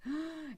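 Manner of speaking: sharp indrawn gasp, high but soft; mock horrified gasp; shocked gasp. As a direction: gasp at the sight of the kitchen. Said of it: "so I was like".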